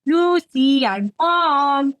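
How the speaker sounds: high, called-out mock Cuban accent. A narrator's reading. Drunk woman announcing herself in the suite, mock Cuban accent shout. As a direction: shout it in your best hammed-up Cuban accent. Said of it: "shouted in my best Cuban accent".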